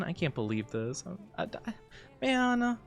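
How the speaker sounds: usual speaking voice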